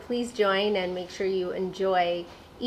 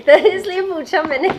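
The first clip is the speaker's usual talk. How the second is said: laughing; 11 semitones higher, 11 dB louder, much faster than usual. Real, not acted. Laughs after joke about secrecy.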